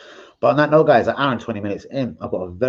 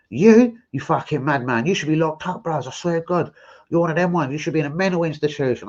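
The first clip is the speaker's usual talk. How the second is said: retold mad outburst; 7 semitones higher than usual. Man going mad on seeing the donation, mock-outraged. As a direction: imitate someone going mad at him. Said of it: "He kinda went mad".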